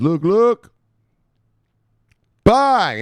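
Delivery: high, loud triumphant bang; excited bang. Real, not acted. Host reveals the launch with a loud triumphant bang. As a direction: slam the reveal home.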